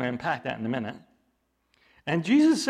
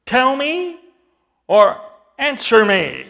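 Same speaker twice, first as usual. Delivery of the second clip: loud, higher demand; very strong words; 8 semitones higher, 9 dB louder, slower than usual. Retold or imitated. Jesus forcefully demands the elders answer him, loudly. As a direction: bark the demand at them. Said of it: "in very strong words".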